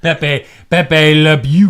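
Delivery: hammy Pepe Le Pew French voice; French accent. Pretend. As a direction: do a cartoon French skunk. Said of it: "I like your French voice".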